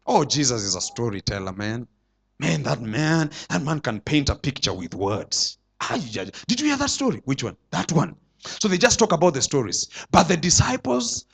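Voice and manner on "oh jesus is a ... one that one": much lower and softer, offhand gossip; mild impression of idle listeners; casual crowd chatter. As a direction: chat lightly like people leaving a meeting. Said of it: "they were just saying".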